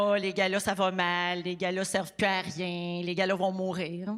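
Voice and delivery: low, muted doom-saying drone; mimicking naysayers. Host quotes critics of award galas, low mock gloom. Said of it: "parce qu'on nous dit tout le temps".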